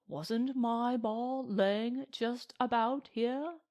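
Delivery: Englishman imitating a cowboy's speech; high-swinging, earnest retelling of a cowboy's speech; Monty's tone. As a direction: mimic a cowboy's solemn appeal in a British voice. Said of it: "caught the tone of Monty's voice".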